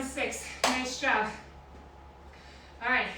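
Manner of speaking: breathless between workout sets; out of breath. Real, not acted. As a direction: talk while catching your breath. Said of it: "Catching our breath here".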